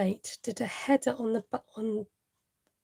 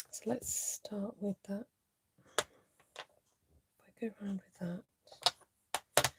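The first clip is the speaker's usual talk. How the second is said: whisper; 9 dB quieter than usual. Real, not acted. Muttering while painting.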